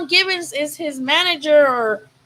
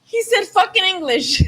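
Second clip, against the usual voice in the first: higher, laughing as he quotes it; 7 semitones higher than usual. Real, not acted. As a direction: repeat it through laughter. Laughing, repeating clip.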